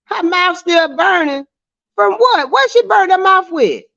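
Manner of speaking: high-pitched, incredulous echo; real puzzled surprise, high voice; surprised questioning. Real, not acted. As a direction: question it in amazed disbelief, pitched right up. Repeats comment back in disbelief.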